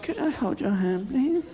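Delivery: meek wife asking. Retold or imitated. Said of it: "my wife said".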